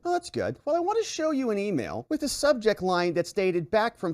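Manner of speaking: high, fake-pleased sarcasm; mock approval. Lawyer sarcastically praising Don's denial, high mock cheer. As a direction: sound falsely delighted at his answer.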